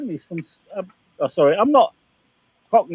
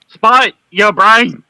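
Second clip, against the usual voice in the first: loud, slow, high call of Spike, your brain; mock alarm; 7 semitones higher, 11 dB louder, much slower than usual. Real, not acted. Teasing Spike about the quacking noise, loud and slow. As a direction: call across the room in mock shock.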